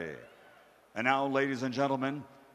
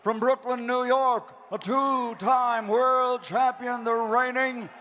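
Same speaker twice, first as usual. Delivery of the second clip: building, stretched fighter introduction; ring announcer intro; 10 semitones higher, 7 dB louder than usual. Acted. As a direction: build to a roaring finish.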